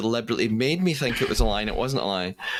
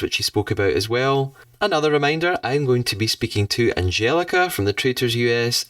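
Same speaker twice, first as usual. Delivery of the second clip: louder, projected host sign-off; podcast outro; 2 semitones higher, 4 dB louder than usual. Real, not acted. Host signing off to listeners after the interview, podcast outro. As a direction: address the listeners with a lifted, energetic read.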